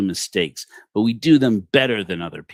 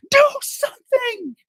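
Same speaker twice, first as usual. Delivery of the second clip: very high, loud shriek; scream for help; 6 dB louder, slower than usual. Acted. Wife screaming at the ceiling camera, full panicked shriek. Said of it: "and screams".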